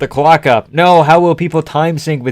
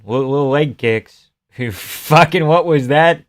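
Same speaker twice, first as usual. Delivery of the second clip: usual speaking voice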